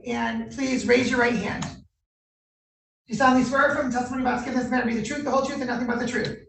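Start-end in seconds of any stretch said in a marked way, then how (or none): 1.6-6.4 rote court oath. Real, not acted. Judge swearing in the officer.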